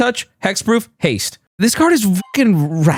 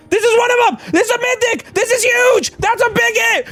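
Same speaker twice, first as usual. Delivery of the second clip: excited hype. Real, not acted. Card opener celebrating a big hit, excited hype. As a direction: yell it way up high, overwhelmed by the pull. Card collector pulls a huge card.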